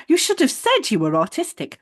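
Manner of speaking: high-pitched imitation of scolding; mock reproachful receptionist. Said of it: "she said something like".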